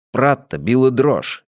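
usual speaking voice

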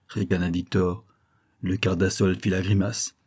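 ordinary delivery

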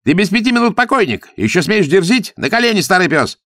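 high, loud, outraged yelling; flaring fury. A narrator's reading. Pkhubu Tsitan threatens Zagorsky, flaring with rage. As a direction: explode at the insolent old enemy. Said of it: "вспыхнул от ярости".

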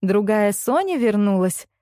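ordinary delivery, nothing marked

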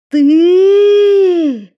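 high, loud hateful cry; furious shout. Acted. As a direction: spit out the word. Will, eyes full of hatred.

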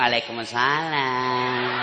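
soft, slow, low sultry greeting; seductive wife. Acted. Wife answering her husband's phone call, soft and sultry. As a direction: coo it slowly to tempt him. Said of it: "Suara begini yang kasih nafsu".

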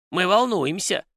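high little creature voice; worried house spirit. A narrator's reading. House spirit nods and speaks up to his master, high anxious voice.

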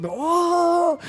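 high hyped crowd "oh"; excited cheer. Retold or imitated. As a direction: whoop like a wild crowd. Crowd cheering, chairs raised.

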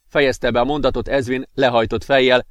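ordinary delivery, nothing marked

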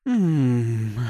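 sultry drawn-out hum; passionate hum. Acted. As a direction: hum it with desire. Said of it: "страстно произнесла она".

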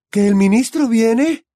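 a mother thrown into panic; Molly hearing the minister is coming; stammered disbelief. A narrator's reading. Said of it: "balbuceó la señora Weasley desconcertada".

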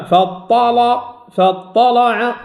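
"فاطلع" with thick L and back A; heavy, thickened lam. Shown as a demonstration. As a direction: chant "فاطلع" slowly with a dark L. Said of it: "الأزرق هنا فخم اللام".

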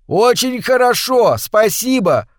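high, loud, slow shout. A narrator's reading. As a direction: yell it over a waterfall. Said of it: "выкрикнул Картер".

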